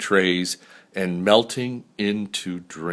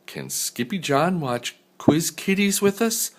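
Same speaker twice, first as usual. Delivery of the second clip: put-on little girl asking; 8 semitones higher than usual. A narrator's reading. Little sister asking Mama.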